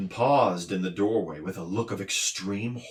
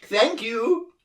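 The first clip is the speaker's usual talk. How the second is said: high groaning creature voice; tearful din thanks Milo; groaned; 13 semitones higher than usual. A narrator's reading. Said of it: "groaned the din".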